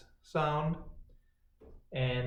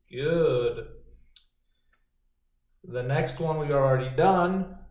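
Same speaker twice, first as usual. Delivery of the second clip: ordinary delivery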